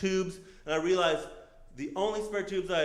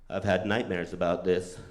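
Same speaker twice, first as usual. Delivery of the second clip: low, ominous confession; ominous driver; 10 semitones lower than usual. Retold or imitated. Subaru driver confessing to her new passenger, ominous. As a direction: drop low for a creepy admission.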